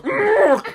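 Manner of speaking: high, loud mock squawk; seagull's crash cry. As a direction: squawk like a bird hitting sand. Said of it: "all you heard was".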